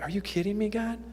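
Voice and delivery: half-pray it in stunned disbelief; hushed aside to God. Retold or imitated. Asking God as the teacher picks up a Bible, hushed wonder.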